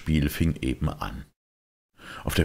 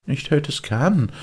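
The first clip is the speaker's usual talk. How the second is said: mocking; 12 semitones higher, 5 dB louder than usual. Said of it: "spottete ihn dieser aus".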